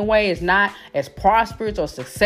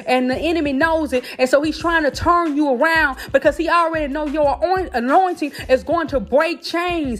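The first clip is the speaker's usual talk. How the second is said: stirred-up preaching; 4 semitones higher than usual. Real, not acted. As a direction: preach it home, building to a fever. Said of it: "Y'all done got me stirred up now".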